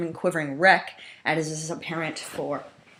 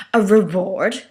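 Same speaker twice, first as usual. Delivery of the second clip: scornful Megatron; 3 semitones higher, 9 dB louder, slower than usual. Acted. Megatron voiced, scornful question.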